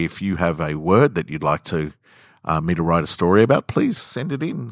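ordinary talk

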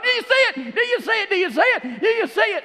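high, loud repeated cry; excited fan. Retold or imitated. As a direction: grab your neighbour and yell. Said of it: "I'm patting him on the shoulder".

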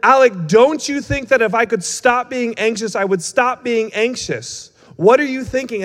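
high, drawn-out exasperated mock yell; imitated angry scream. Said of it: "she screamed in my face".

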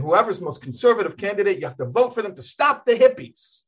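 lightly voiced fiery preacher; railing preacher. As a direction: ham up an old rabbi railing from the pulpit. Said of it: "He's saying".

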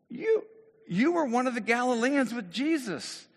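high, loud finger-pointing; acted outraged accuser; loud accusation. Acts third accuser.

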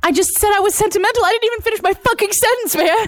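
indignant protest. Real, not acted. Panelist cut off during a timed debate, an exasperated protest. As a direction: complain that you got cut off.

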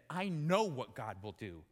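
low, flat certainty. Acted. Jonah refusing to go, low and stubborn. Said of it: "that he said".

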